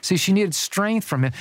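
ordinary delivery

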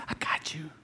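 soft whisper, tender and close; whispered reassurance. Pretend. Preacher whispering God's comfort to a ten-year-old. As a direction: whisper it like comforting a scared child. God reassuring the anxious child.

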